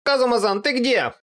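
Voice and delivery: high-pitched wail, calling out blindly; panicked calling. A narrator's reading. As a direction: call out high and helpless. Аква зовёт, ослеплённая. (панический высокий зов)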